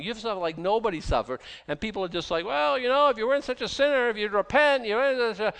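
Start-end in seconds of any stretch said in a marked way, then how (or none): 2.5-5.5 high, loud, preachy mock scolding; mocking accusers. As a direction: parody the self-righteous friends. Mimicking Job's accusing friends.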